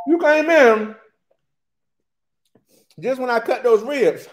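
talking through a mouthful; chewing while talking; mouth full. Real, not acted. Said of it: "Talking with my mouth full".

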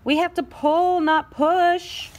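"pull" and "push" hit high and drawn out; contrastive stress. Acted. Scissors corrects Pushpin, stressing "pull" and "push".

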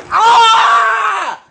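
sharp cry of pain; pained yell. Real, not acted.

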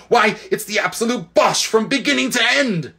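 put-on high, outraged girl's cry; indignant shout. Polly, fed up with the Queen's boasts, shouts indignantly. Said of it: "shouted Polly".

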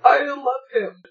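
normal talking voice

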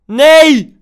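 loud, high despairing no; anguished cry. Real, not acted. Anguished at a missed chance.

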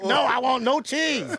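high, slow fussy customer. Retold or imitated. A customer ordering during a taped set, high fussy whine.

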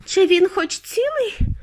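high, drawn-out worried question; worried, slow and high; anxious mother. A narrator's reading. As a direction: ask it anxiously, pitched well up. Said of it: "тривожилася пані Тіві".

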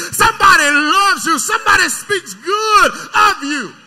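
loud, crying preacher's whoop; preaching at full force. Acted. Preacher to his church about bad bread, full-force crying shout. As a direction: pour it out over the congregation.

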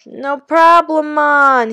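put-on reassuring boy in a big Jamaican voice; Jamaican accent. A narrator's reading. Mister Kincaid's lines.

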